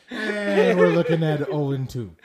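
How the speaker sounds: loud play-by-play announcer; baseball voice. Acted. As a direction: call the count like a radio commentator. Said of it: "such a baseball voice".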